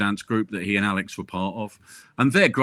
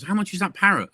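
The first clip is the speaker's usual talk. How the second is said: lightly voiced higher customer; joke's customer; 9 semitones higher than usual. Woman asking in a pet shop, lightly voiced customer. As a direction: ask it lightly, pitched up. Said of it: "and she says".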